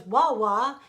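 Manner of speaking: loud Helen Keller breakthrough cry. Retold or imitated. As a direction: call it out loud, imitating a first word. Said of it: "and she goes".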